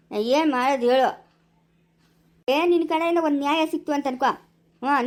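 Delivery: normal talking voice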